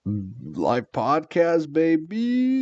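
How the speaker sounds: slow drawn-out hype, rising high; hyped-up. Real, not acted. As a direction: stretch it out like a hype man. Hyping the live podcast.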